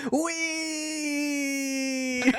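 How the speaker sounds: long, high, thin "wheee"; gleeful rider's whee. Acted. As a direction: squeal it out like a joyride. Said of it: "on the back going".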